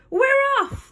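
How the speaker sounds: very high, contained cheer; crew shout. A narrator's reading. Crew answers the captain as they leave, shouting. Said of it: "they all shouted".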